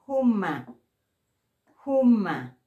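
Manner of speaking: loud JUMA with a plain Spanish j; JUMA, firm and loud on a simple j; clean J. Shown as a demonstration. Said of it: "J limpia".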